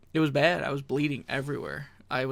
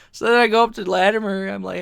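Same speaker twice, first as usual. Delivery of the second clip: ordinary delivery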